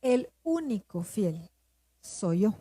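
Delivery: slow, weighty retelling of God's reply; lightly voiced God, words drawn out and spaced; God's emphatic answer. God answers her tearful complaint, slow and emphatic. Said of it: "tan contundente su respuesta".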